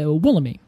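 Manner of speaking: casual "Wollemi" ending in "mee"; as-read pronunciation. Shown as a demonstration. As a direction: toss off "Wollemi" with a final "ee". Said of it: "the way that I would read it".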